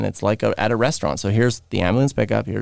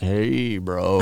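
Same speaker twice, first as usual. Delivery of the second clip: long, drawn-out drugged greeting, low and soft; 4 semitones lower, 4 dB quieter than usual. Retold or imitated. Injured friend greeting his buddy as drugs kick in, drugged-up. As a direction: imitate a friend as painkillers kick in. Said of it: "now the drugs are kicking in".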